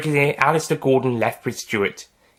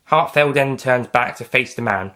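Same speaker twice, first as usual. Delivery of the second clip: ordinary delivery, nothing marked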